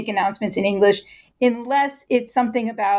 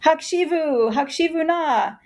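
camp call; 5 semitones higher than usual. Shown as a demonstration. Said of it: "start the announcements with that".

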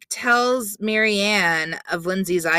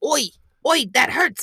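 mock Brooklyn-cockney; 8 semitones higher, faster than usual. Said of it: "As we say in Brooklyn".

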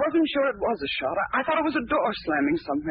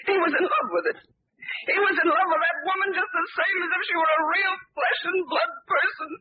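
tearful outburst; 8 semitones higher than usual. Acted. Wife's emotional outburst about husband.

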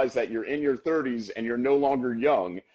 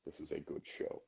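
much lower, much softer, barely voiced; deadpan awed; 9 semitones lower, 16 dB quieter than usual. The host describing his reaction to the show, low and hushed. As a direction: murmur it in stunned appreciation. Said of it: "And I just go".

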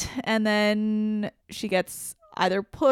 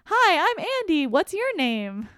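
put-on high, bright little boy greeting; eager child saying hello; kid voice; 9 semitones higher than usual. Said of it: "He takes the doll out and says".

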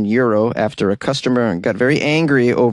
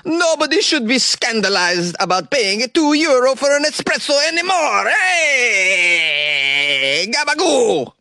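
high, hammed-up Italian accent; 12 semitones higher than usual. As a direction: play a cartoonish Italian café owner. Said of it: "The owner of this particular coffee bar".

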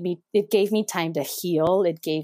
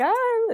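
mock wail. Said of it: "we were both bawling and just being like".

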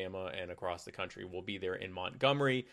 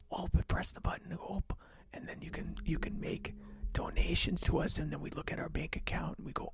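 whispered mock-Midwestern plea for donations; creepy Midwestern whisper; 6 semitones higher than usual. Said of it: "a very creepy way to end".

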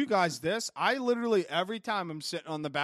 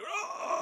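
strained choking grunt; 13 semitones higher than usual. Pretend. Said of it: "just be like".

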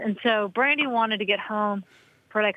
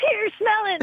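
much higher, pushy mock offer; eager offer; 13 semitones higher than usual. Prankster to teammates, pushy high eager offer. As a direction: shove the smelly shin guard at them, eager. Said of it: "And I'd be like".

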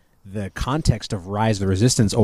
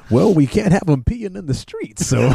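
high, slow put-on voice; drawn-out comic impersonation; Walt Disney; 8 semitones higher than usual. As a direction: ham it up as a folksy quote. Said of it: "And Walt said".

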